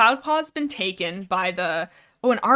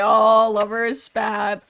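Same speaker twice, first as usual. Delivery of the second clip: slow, drawn-out teasing; teasing crowd; 6 dB louder, much slower than usual. Retold or imitated. The clan teasing the pair, slow and mocking. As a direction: tease the pair slowly like gossiping warriors. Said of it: "Everybody's like".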